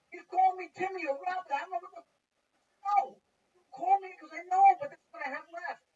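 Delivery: loud, high-pitched griping; exasperated complaint. Retold or imitated. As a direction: argue at the top of your voice. Acted row over wrong name.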